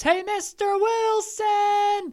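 high, drawn-out shout across the street. A narrator's reading. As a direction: call out his name, loud and high. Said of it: "The boy shouts".

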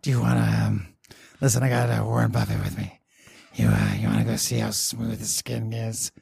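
low, smooth, oily ghoul voice. Pretend. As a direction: purr it like a sleazy ghoul. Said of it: "Hancock just talks like a dude".

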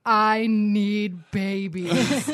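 loud, hugely drawn-out mock plea; hammed-up baby longing. Aunt in the front seat melting over niece, hammed-up longing. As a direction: stretch every word in exaggerated yearning.

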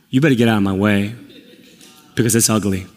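low, ominous mock threat; half-joking Hulk menace; menacing warning. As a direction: warn them off in a low, deliberate voice. Warns as the turning Hulk.

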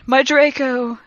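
moaning. A narrator's reading. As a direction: moan it melodramatically. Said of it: "he moaned".

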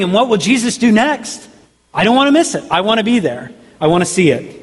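high-pitched acted anticipation, easing down at the end; eager onlooker. Crowd gathering to watch Jesus, eagerly excited. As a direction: play an excited spectator afraid of missing out.

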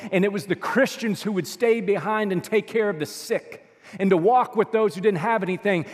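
usual speaking voice

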